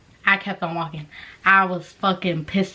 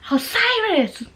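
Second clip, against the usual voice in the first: high-pitched mimicked name call; mock calling voice, pitched way up; imitated call-out; 12 semitones higher, slower than usual. Retold voice calling out to her.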